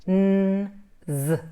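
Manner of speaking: N hummed long, then a buzzed z; voiced consonants. Shown as a demonstration. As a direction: sustain the N and voice the z. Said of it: "consonants that have got sound or voice".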